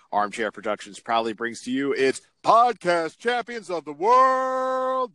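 booming announcer intro, drawn out; ringmaster. Pretend. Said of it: "that was a heck of an introduction".

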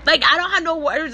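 slow, halting, close to crying; holding back tears. Real, not acted. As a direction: fight tears while speaking to God. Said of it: "I'm trying not to cry".

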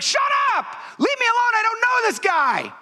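high, shouted furious denial; angry denial. Acted. Peter disowning Jesus to the crowd, angry high shouting. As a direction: shout them off, pitch soaring.

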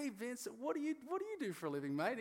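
high, cheerful clueless question; mock-innocent small talk, pitched high; clueless self-quote. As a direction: ask it like you have no idea who he is. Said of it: "I'm sitting there going".

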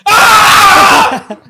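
loud, high shrieking laughter; screaming laugh. Real, not acted. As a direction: burst out in a howling laugh. Howling laugh after scream talk.

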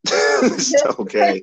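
high giggly words through a laugh. Real, not acted. Laughing along with her cohost's harsh joke. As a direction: laugh it out slowly.

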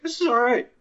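high-pitched mock surprise; retold reaction in a high voice; pleasantly surprised quote. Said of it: "and was like".

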